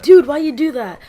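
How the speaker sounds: angry shout. Retold or imitated. Angry kid confronting classmate.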